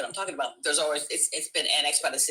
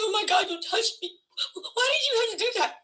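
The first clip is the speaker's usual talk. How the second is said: high-pitched, wounded wail; mock victim distress. Fake victim confronting the woman before cops, mock distressed pleading. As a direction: plead in put-on hurt.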